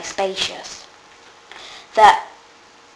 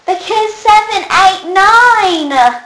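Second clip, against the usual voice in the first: high gleeful punchline; joke punchline; 11 semitones higher, 6 dB louder, slower than usual. A narrator's reading. Child telling the joke to everyone, gleeful. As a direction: deliver the punchline with glee.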